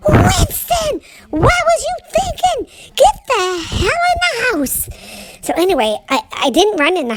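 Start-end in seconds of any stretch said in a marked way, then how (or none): 0.1-1.0 angry holler. Retold or imitated. Dad spots the dog and yells its name. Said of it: "my daddy hollered". 1.3-4.9 much higher, shouting, slow on the order; angry holler. Retold or imitated. Dad orders the runaway dog inside, shouting. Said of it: "my daddy hollered".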